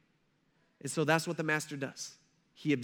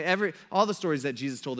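ordinary talk

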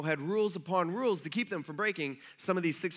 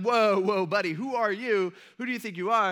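loud, raised mock confrontation; loudly acted officious outrage; indignant challenge; 3 semitones higher, 7 dB louder than usual. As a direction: stop him short, acting the outraged official. Leaders stop and challenge the man.

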